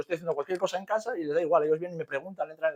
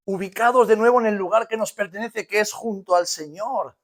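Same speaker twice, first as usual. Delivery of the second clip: preaching climax; 7 semitones higher, 8 dB louder than usual. Acted.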